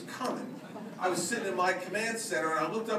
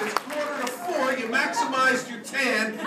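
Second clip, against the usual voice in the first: blunt mock scolding; 6 semitones higher, 5 dB louder than usual. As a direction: scold the sunbathers, then bark the command. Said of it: "I looked at my watch and said".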